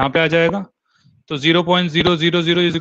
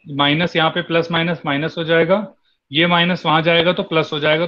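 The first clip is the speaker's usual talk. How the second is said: ordinary delivery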